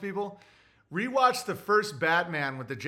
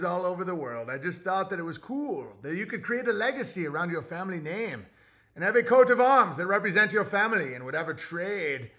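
put-on old-timey voice; 2 semitones higher, 3 dB louder than usual. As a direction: read it like a vintage radio announcer. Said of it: "Read an old-timey voice".

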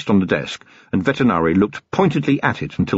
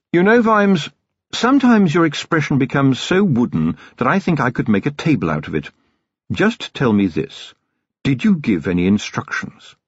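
Vetinari's dry voice; 6 semitones higher than usual. A narrator's reading. The Patrician lecturing Vimes, cool and measured. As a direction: lecture him with icy patience.

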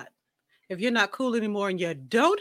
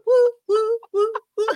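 high, loud whooping; cheering woo; 13 semitones higher, 6 dB louder than usual. Real, not acted. Guest hyping up the show with high whoops. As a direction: whoop like a crowd.

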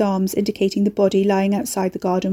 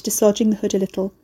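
ordinary delivery, nothing marked

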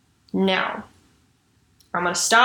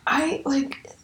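whispering; 5 semitones higher, 6 dB quieter than usual. Real, not acted. Disbelief at chaotic relationship.

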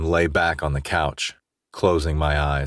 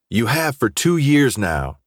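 higher-pitched, pointed reproach; angry accusation; 7 semitones higher than usual. A narrator's reading. Friend shoves Jace away mid-quarrel, accusing. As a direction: throw the blame at him, heated.

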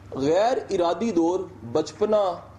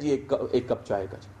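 timid polite order; 5 semitones lower, 4 dB quieter than usual. Retold or imitated. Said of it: "ہم کہتے ہیں".

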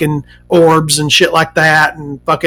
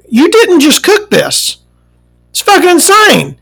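indignant complaint; 11 semitones higher than usual. Retold or imitated. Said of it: "and I'm like".

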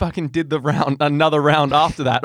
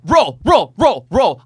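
called out command; 5 semitones higher, 6 dB louder, much slower than usual. Retold or imitated. Referee calling the start.

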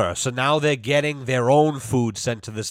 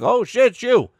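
much higher, a startled squeak for the dog; dog's startled voice; 9 semitones higher than usual. Pretend. High surprised squeak voicing the deaf dog at the top of the stairs. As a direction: voice the old dog suddenly noticing you. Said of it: "she's like".

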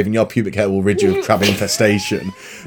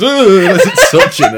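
high, laughing through the words; 5 dB louder, slower than usual. Real, not acted. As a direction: laugh while telling it. Laughing retelling.

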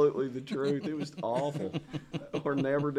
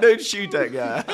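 laughing mock order; 6 dB louder, slower than usual. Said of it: "They're like".

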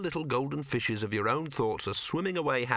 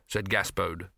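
ordinary delivery, nothing marked

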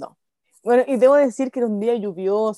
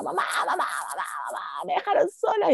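high repeated calls for mum; mock wail, high and insistent; retold child's yelling; 4 semitones higher than usual. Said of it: "entonces yo gritaba".

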